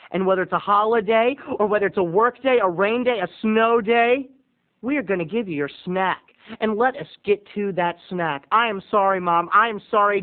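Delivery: usual speaking voice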